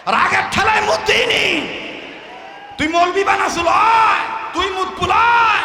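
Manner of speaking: high, loud, scolding yell; furious shouting woman. Acted. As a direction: tear into him at full voice. Angry woman scolding in story.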